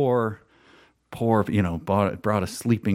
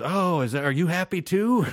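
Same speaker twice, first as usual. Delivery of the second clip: high, mocking sing-song question; a lightly voiced taunting gunman; mocking tweaker; 10 semitones higher than usual. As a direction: taunt the friend too. Gunman turning to the friend.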